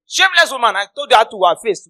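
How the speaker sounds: high, loud scolding; loud denunciation. Real, not acted. Preacher condemning a mother from church, indignant. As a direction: denounce her at full voice.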